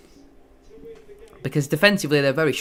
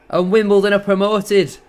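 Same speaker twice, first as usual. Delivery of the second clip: loud, high burst of joy at the final whistle; excited shout; 6 semitones higher, 6 dB louder than usual. Real, not acted. Fan calling the promotion live on stream, excited shout.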